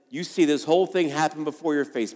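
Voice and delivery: ordinary delivery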